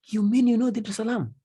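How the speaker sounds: high, quick amazed question; surprised question. Acted. Traveller meeting Mohammed in Dar es Salaam, amazed and high. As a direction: act amazed at meeting him here. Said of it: "you tell him".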